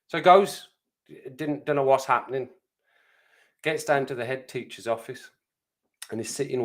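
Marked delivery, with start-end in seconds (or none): none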